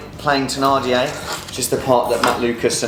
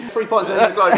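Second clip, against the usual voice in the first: laughing; 5 semitones higher than usual. Acted. Jokey ordering at a pub.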